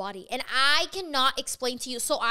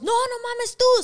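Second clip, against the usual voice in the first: higher, louder angry retort in Spanish; pissed cook; 9 semitones higher, 5 dB louder than usual. Retold or imitated. Cook yelling at the cashier, loud and angry. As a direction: snap back at her, hot and raised. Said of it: "So he's pissed".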